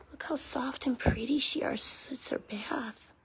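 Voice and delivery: hushed, tender whisper over the dog; soft whisper. Real, not acted. To the freshly bathed dog, a soft whisper.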